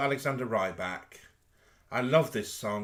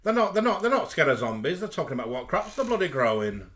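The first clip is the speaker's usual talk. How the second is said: loud Lancashire rant, starting high and settling; mock-indignant Lancashire impression, emphatic at the start; high-pitched Lancashire accent; 3 semitones higher, 5 dB louder than usual. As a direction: imitate a complaining Lancashire character. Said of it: "sort of high-pitched Lancashire accent".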